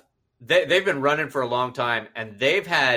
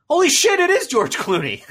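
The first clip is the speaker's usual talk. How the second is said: shocked; 14 semitones higher, 5 dB louder than usual. Retold or imitated. Retold shocked recognition.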